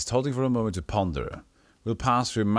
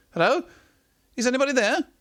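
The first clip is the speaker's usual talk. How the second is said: high, loud call through a door; 5 dB louder, faster than usual. Acted. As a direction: shout a hopeful hello into the house. Calling at the door.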